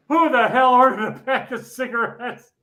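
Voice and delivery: mock fury at the cigarette order; retold angry yell. Bowling supervisor yelling at the crew, in mock anger. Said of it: "and he is so pissed off".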